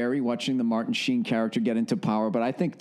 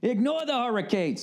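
high-pitched mock hype shout; over-the-top high yell; ear-blasting holler; 8 semitones higher than usual. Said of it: "Sorry, just blew out yours".

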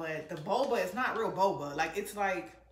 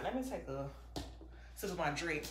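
normal talking voice

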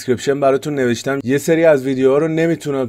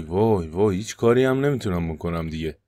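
ordinary talk, nothing marked

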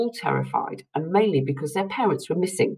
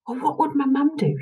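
to herself; 11 semitones higher than usual. A narrator's reading. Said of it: "she said to herself".

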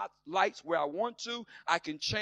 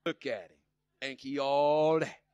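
much lower, slow snide drawl; mock the man with the new car; catty sneer; 6 semitones lower than usual. Jealous onlooker sneering at a new car, low and slow. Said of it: "somebody come driving up".

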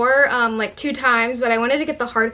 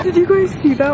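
startled; 7 semitones higher, 4 dB louder than usual. Real, not acted. Nearly dropped the egg rack.

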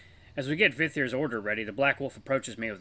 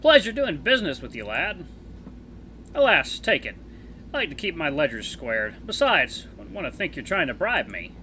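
put-on higher, genial older wolf voice; friendly older wolf; 5 semitones higher, 6 dB louder than usual. Elder wolf offering to take the pup as apprentice, genial and higher. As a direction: play the kindly elder wolf teasing his friend. Voices Vithir in visual novel.

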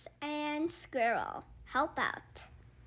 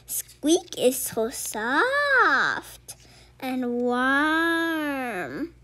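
tender slow coo; 5 dB louder, much slower than usual. Acted. Fox cooing over the creature, slowly. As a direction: savour each word lovingly.

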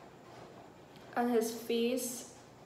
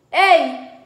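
sudden high loud call; husband calling; 6 semitones higher, 18 dB louder than usual. Acted. Angry husband calling his wife in the kitchen, loud. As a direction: bark it out across the room. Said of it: "he called".

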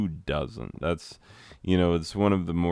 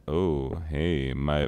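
ordinary talk, nothing marked

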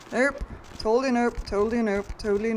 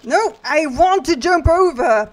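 loud, raised protest; frustrated shout; 5 semitones higher, 8 dB louder than usual. Real, not acted. Player who cannot jump the obstacle, frustrated shout. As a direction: shout it like a thwarted demand. Game will not let them jump.

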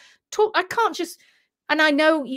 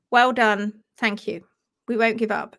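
normal talking voice

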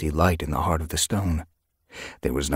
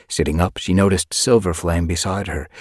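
plain reading voice

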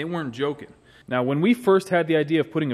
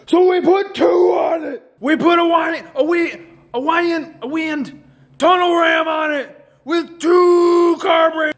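full hype shout; 10 semitones higher, 6 dB louder than usual. Acted. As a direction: blast the price like an announcer. Climax of price hype.

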